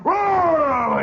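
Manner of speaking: loud, high imitated bellow. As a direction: bellow a long roaring cry. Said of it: "he bellows like that".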